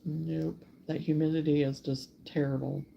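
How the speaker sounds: usual speaking voice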